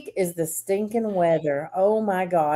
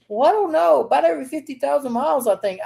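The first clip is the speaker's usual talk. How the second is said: higher, unsure mock of a clueless employee; 7 semitones higher, faster than usual. Imitating the tire guy guessing rotation mileage. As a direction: play the guy who guesses the answer. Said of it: "the guy's like".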